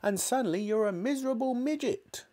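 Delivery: put-on high-pitched furious old-woman rant; grandma yelling. A narrator's reading. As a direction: rage indignantly in a high voice. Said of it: "she yelled".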